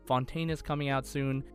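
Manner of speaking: ordinary delivery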